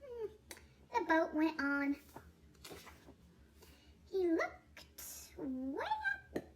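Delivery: usual speaking voice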